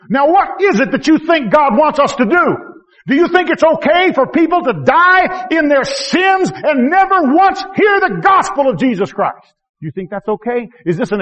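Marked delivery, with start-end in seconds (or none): 0.1-9.5 much higher, louder, slow shouted challenge; full-force preaching. Acted. Preacher challenging the congregation, loud slow shout. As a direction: thunder the question at them.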